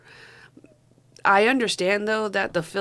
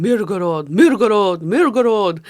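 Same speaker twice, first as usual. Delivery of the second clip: "Mirgorod" shouted louder each time, with a falling tune; mock heavy emphasis; 3 semitones higher, 9 dB louder than usual. Mocking the dubbed actors who keep stressing "Mirgorod", loud and hammed-up. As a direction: bellow "Mirgorod" over and over like the overdone dub. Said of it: "put so much emphasis on the word Mirgorod".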